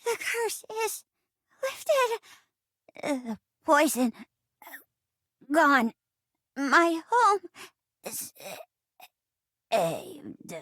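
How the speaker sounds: hammed-up death scene, broken by pauses; tearful dying gasps. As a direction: gasp it out haltingly, fading at the end. Sprite's tragic death scene.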